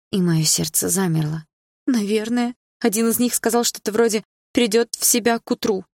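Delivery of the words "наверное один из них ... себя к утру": frightened, higher-pitched hushed voice; trembling whisper. A narrator's reading. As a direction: report it fearfully, voice trembling. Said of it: "прошептала Саша дрожащим голосом". (дрожащим голосом, испуганно)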